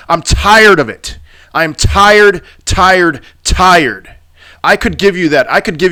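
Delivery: venting real frustration at chart gurus; angry outburst. Real, not acted. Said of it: "why I'm so angry right now".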